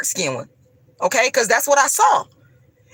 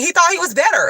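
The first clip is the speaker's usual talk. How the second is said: pitched-up scornful exclamation; indignant outburst; 8 semitones higher, faster than usual. Real, not acted. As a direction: spit out the scorn. Indignant outburst about church leader.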